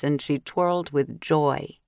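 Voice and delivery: ordinary delivery, nothing marked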